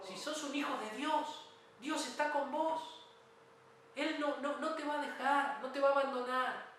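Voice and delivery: rousing preacher's conviction; impassioned preaching. Real, not acted. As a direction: drive each promise home to the congregation. Preacher assuring believers at full force.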